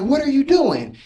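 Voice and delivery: high, sharp mock alarm; alarmed shout. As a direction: yell it as the startled girlfriend. Girlfriend yelling at intruder.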